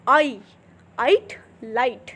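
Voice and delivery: slowly drawn आइट, light; slow teacher demo of the diphthong; slow demonstration. Shown as a demonstration. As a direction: stretch the vowels in आइट and light. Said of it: "तो आई".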